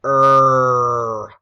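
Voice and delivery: loud, long, held "er"; sustained "er", demonstrated for spelling; isolated vowel sound. Shown as a demonstration. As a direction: stretch "er" out loudly on one steady note. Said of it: "Number two is".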